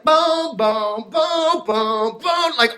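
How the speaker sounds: pounding boom. Acted. Pounding on building ledge.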